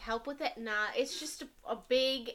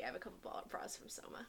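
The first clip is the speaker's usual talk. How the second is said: whispering; 4 semitones lower, 18 dB quieter than usual. Real, not acted. Confides about her bras.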